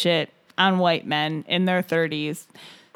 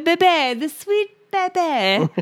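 put-on higher, slow cooing baby talk; 7 semitones higher, slower than usual. Calling herself the sweet baby, slow high cooing. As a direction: coo it like talking to an infant.

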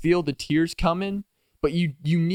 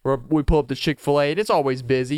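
ordinary delivery

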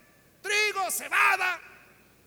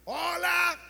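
calling out; 5 semitones higher, 5 dB louder than usual. Retold or imitated. Lepers calling into empty camp.

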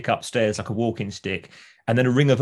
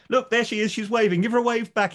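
much higher, eager neighbour voice; Mister Sweeney; 12 semitones higher than usual. Retold or imitated. Neighbour urging Victor to wave at mother, high and eager. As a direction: insist brightly, pitched way up. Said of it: "he says".

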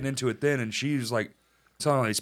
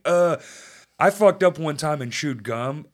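ordinary delivery

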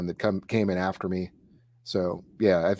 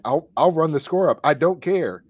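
mildly heated coach quote, a bit louder; raised, mock-angry retort; retold angry coach; 5 semitones higher, 5 dB louder than usual. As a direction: retell a coach's defiance, raised voice. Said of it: "it's the angriest I've ever seen Coach Long".

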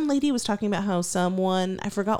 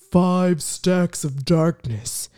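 Zaddy; 7 semitones lower, much slower than usual. Retold or imitated. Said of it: "He is hyping it up".

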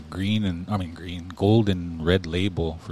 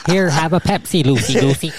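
auntie voice; 7 semitones higher, 6 dB louder than usual. Retold or imitated. Voicing auntie from Smoke Signals.

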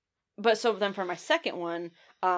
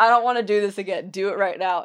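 mild urging; 7 dB louder than usual. Retold or imitated. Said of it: "I was like".

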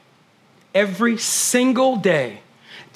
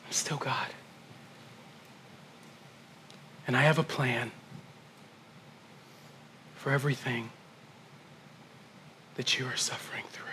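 soft, tender whisper; gentle whisper; 5 semitones lower, 17 dB quieter than usual. A narrator's reading. Holy Spirit gently telling the pastor, in a whisper. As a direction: hush it gently like a comforter. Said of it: "because he's so gentle".

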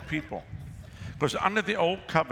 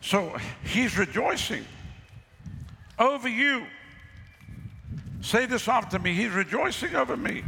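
call and response preaching; 8 semitones higher than usual. Real, not acted. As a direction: draw the people into saying it.

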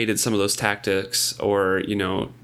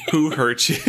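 laughing; 3 semitones higher than usual. Real, not acted. Teasing the author, laughing.

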